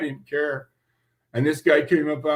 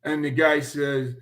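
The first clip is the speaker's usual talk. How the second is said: normal talking voice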